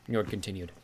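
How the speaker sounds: ordinary delivery, nothing marked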